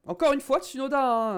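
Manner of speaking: can't believe it again; exasperated. Real, not acted. Tsunoda crashes again.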